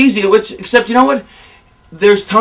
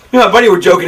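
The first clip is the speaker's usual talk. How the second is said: normal talking voice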